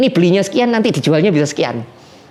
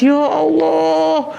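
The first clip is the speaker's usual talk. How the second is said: tearful cry to God; 9 semitones higher than usual. Retold or imitated. Desperate man crying to God after the unexpected help, high and tearful. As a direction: wail it out to God, overwhelmed with relief. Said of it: "dia nangis".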